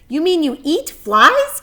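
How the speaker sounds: loud, high and slow horrified gasp; gasping shock. A narrator's reading. Shocked pig gasping at the spider. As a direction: recoil at the idea. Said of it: "gasped Wilbur".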